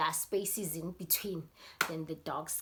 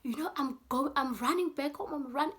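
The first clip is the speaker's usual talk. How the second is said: higher, hurried scared voice; much higher voice about to flee; panicking friend; 9 semitones higher, 4 dB louder, faster than usual. Retold or imitated. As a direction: blurt it out in a fright. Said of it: "is panicking and telling me".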